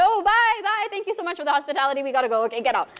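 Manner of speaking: high, fast and nervous; flustered rushed goodbye. Retold or imitated. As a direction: babble goodbyes in a hurry. Hurried flustered goodbye to the man.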